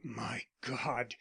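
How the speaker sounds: the words drain out of him in fear; terrified. A narrator's reading. Arnold realises who Marsland is, soft terrified gasp. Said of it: "a look of terror in his eyes".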